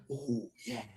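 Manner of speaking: low, soft mock whisper. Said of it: "don't whisper".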